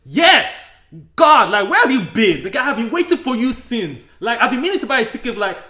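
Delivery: loud, high outraged tirade at the inspector; loud high indignant rant at the ticket man; mock angry rant. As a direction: rant like an offended customer, fast in places. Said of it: "I got angry".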